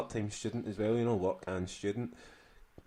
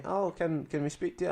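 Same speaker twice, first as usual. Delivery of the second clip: student voice; 7 semitones higher than usual. Retold or imitated. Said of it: "I will try not to do the accent".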